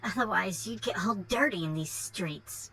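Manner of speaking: put-on spoiled Valley Girl brat; Valley Girl accent. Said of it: "Valley Girl accent going".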